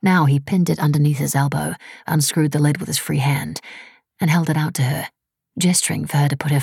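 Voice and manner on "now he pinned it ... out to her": usual speaking voice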